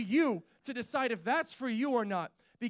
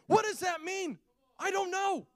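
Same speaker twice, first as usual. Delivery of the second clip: high-pitched bewildered reply; 9 semitones higher than usual. Acted. Man answering God's questions, high bewildered voice. Acted helpless reply to challenge.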